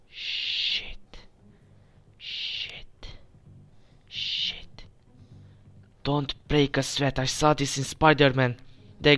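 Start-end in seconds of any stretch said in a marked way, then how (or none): 0.1-4.8 whispering. Real, not acted. Hiding from guards in game.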